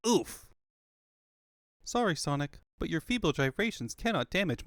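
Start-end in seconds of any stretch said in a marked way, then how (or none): 0.1-0.5 high, pained oof; oof grunt. Acted. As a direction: grunt from the hit. Hit grunt.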